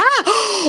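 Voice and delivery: sharp high gasp of shock. Acted. Hearing a shocking solicitation, gasping high. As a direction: gasp as if stunned.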